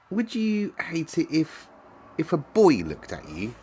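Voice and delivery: high, hesitant question; uncertain. A narrator's reading. Girl asking the boy about being looked at, uncertainly. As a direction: ask tentatively, halting midway. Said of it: "Mary asked uncertainly".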